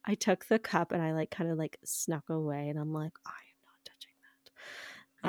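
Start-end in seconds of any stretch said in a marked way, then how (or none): 3.2-5.3 hushed, horrified under the breath; shocked whisper. Retold or imitated. Daughter eyeing dad's strange dessert, in a horrified whisper. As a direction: whisper it in disbelief. Sneaking away with the cup.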